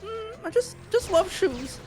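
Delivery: squeaky high nervous goblin. Acted. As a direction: pitch way up as a timid goblin. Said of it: "my name's Shoes".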